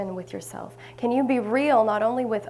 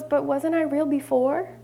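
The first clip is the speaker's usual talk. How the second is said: lightly voiced rabbit, higher questioning tone; a hint of a puzzled toy rabbit, pitched up; 6 semitones higher, 3 dB louder than usual. Rabbit asks the fairy, higher puzzled question.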